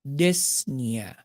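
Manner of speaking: Dyspnea said slowly and set apart after a pause; emphasized term. Shown as a demonstration. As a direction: lean on Dyspnea as the key word of the lesson. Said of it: "I want to emphasize dyspnea".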